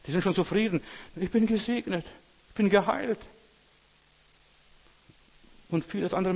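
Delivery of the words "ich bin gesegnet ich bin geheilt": mock smug believer. Preacher mimicking complacent Christians, mocking. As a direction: parody the contented churchgoer.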